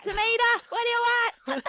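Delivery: loud, high New York yelling; New York accent. Retold or imitated. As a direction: bark it like an impatient New York deli guy. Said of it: "they'd be like".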